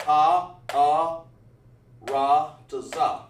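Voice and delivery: "Ah, ah, ra, to za" said loud and slow; loud, slow "Ah, ah, ra, to za" modelled for students; slow teaching demo. Shown as a demonstration. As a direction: sound out each syllable of "ra, to za" clearly for the class. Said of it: "how the teacher wanna explain it".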